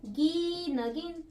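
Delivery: गी नगीन said slowly and drawn out; a teacher reading slowly for children; slow blending. Shown as a demonstration. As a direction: draw out गी नगीन syllable by syllable. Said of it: "अगर इसको दोनों को एक ही साथ पढ़े".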